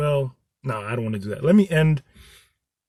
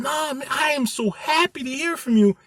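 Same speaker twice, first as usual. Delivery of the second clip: much higher, bright mock reassurance; high, upbeat imitated cheer; happy reply; 10 semitones higher than usual. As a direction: sound thrilled to hear from them. Cheerful answer to caller.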